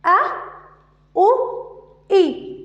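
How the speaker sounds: أ، أ، إي said as clear glottal a, u, i; hamza sounds demo. Shown as a demonstration. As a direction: sound out أ، أ، إي one by one. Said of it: "فألفظها كالتالي". (نطق واضح للهمزة)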